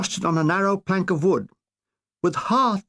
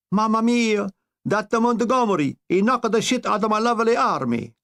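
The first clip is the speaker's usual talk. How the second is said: hammed-up Italian Mussolini, higher; mock Italian accent; 4 semitones higher than usual. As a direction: pile on the Italian accent, whining. Mussolini voiced in comic sketch.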